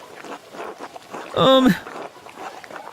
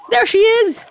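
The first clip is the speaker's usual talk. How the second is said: squeaky high voice. Acted. Greets little Ursula.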